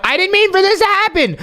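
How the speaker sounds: high, rushed excuse; frantic pleading. Acted. Host begs the betrayed viewer to believe, frantic. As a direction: plead innocence in a fast, high voice.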